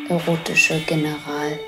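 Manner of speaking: normal talking voice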